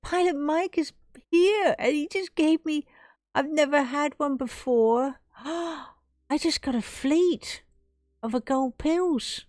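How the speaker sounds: gasping, high and loud disbelief; can't believe the gift, gasp and squeal it; overjoyed. Real, not acted. Streamer overwhelmed by Pilot Mike's huge gift, high loud gasps.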